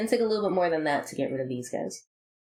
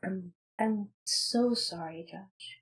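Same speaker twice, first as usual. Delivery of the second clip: quiet cold apology; 7 dB quieter, much slower than usual. Acted. Regina quiet farewell.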